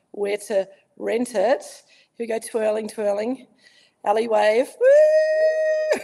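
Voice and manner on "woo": high cheering woo then laugh; excited woo. Real, not acted. Cheering at the wave.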